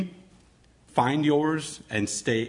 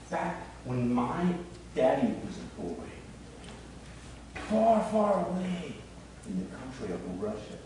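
soft, gentle storytelling voice; a soft storyteller telling children a tale; reading to children; 7 dB quieter than usual. Acted. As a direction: tell it softly to the kids on the floor.

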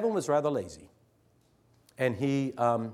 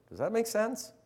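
much higher, sarcastic question; mocking; 11 semitones higher than usual. Teacher to the class, mocking the boy's answers. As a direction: pitch it up to ridicule.

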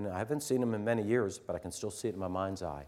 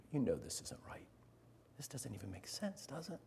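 much softer, near whisper, kindly; soft and gentle; 13 dB quieter than usual. Retold or imitated. Teacher to lazy pupil, gentle soft correction. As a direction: coax the boy quietly. Gentle words he should have said.

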